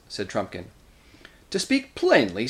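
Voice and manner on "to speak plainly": higher-pitched, heated opening; angry quarrel. A narrator's reading. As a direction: cut in with heated bluntness. Nickabrick attacks the doctor in council.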